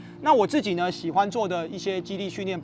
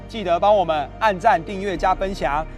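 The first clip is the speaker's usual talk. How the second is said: ordinary talk, nothing marked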